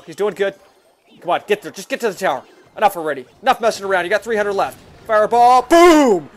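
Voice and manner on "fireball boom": loud, high victory boom; triumphant shout. Real, not acted. Player finishes the tower with a fireball, loud triumphant shout. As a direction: slam the win home.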